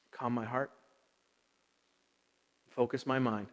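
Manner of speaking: low, soft prayer with long silences; quiet prayer. Real, not acted. Pastor asking the Holy Spirit to calm his heart, quiet prayer. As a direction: pray gently, pausing between short petitions. Stops to pray for focus.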